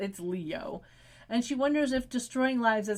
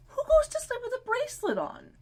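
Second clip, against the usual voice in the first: high-pitched, incredulous disbelief; incredulous question. Real, not acted. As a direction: ask it high, as if it's absurd.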